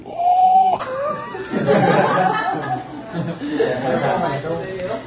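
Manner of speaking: high rising shout of laughter. Real, not acted. Laughs loudly at the story. (carcajada aguda)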